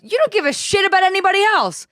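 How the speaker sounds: high, accusing mock yell; hammed-up outraged stranger; imitating screaming older woman. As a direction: hurl it high, as her. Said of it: "this woman's screaming at me".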